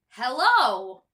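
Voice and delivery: loud friendly hello; cheery greeting. Acted. Mister Chatterbox at the door, cheerfully loud. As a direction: call it out at the door. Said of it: "said Mister Chatterbox".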